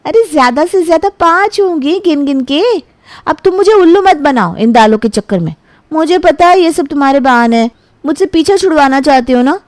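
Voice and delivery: a hint of an annoyed girlfriend; girlfriend's voice. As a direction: girlfriend accusing her boyfriend of excuses, mildly sulky.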